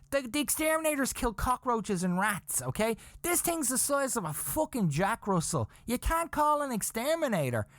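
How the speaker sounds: high-pitched, panicky rant; hammed-up hysterical protest; retold freaking out. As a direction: argue frantically, voice pushed way up. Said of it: "I'm freaking out".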